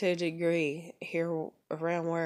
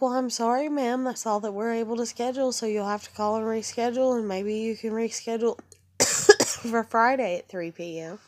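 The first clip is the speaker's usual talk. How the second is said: higher, louder fake-sweet ma'am voice; mock polite dispatcher; 5 semitones higher, 5 dB louder than usual. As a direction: rattle off the brush-off briskly.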